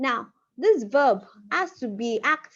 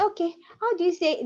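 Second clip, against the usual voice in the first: mock learner; 8 semitones higher than usual. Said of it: "you want to say".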